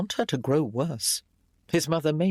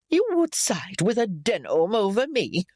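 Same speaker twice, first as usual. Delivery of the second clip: higher, wounded indignation; indignant disbelief; 5 semitones higher than usual. A narrator's reading. Mother to son who defends his wife, indignant. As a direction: accuse in an offended, pitched-up matriarch voice.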